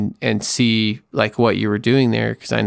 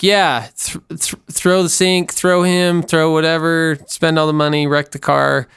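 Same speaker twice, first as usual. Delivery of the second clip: high, emphatic mock cheer; egging on; 5 semitones higher than usual. Women listeners backing the betrayed wife's rage, egging her on. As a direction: urge her on item by item, pitched up.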